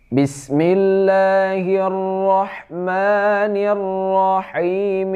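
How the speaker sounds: high, slow chanted recitation; connected recitation. Shown as a demonstration. Teacher reciting the verses joined without pause, chanted slowly.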